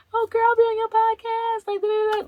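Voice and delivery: very high squeaky put-on voice; mock gushing. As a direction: imitate people chattering way up high. Mocking what some people say.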